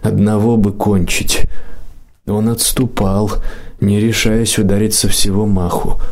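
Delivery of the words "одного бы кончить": ordinary delivery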